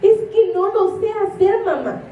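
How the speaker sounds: put-on high whiny excuse from a child; whiny daughter. Mother imitating her daughter Lian's high whiny excuse. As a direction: whine it like a girl dodging chores. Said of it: "Lian así me dice".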